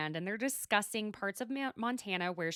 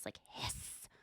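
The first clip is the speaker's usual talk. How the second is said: breathy cat hiss; a quiet, voiceless hiss; imitated hiss; 12 dB quieter than usual. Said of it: "a hissy face".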